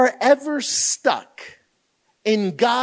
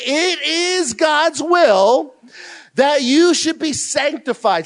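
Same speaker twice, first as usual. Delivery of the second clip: slow, loud and higher, stretching every word; preached proclamation; 6 semitones higher, 3 dB louder, slower than usual. Shown as a demonstration. Pastor reading the verse aloud to counselees, slow loud proclamation. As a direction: proclaim it like a verdict. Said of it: "it says this".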